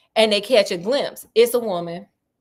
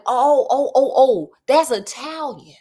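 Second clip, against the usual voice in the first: raised, excited string of ohs; sudden realization; 4 semitones higher, 4 dB louder than usual. Retold or imitated. Sherlock recognizes the candle message as Italian, sudden realization. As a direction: let it dawn on you all at once.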